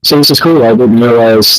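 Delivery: painfully loud mic audio; booming through a hot mic; blaring mic. Real, not acted. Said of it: "Too too loud".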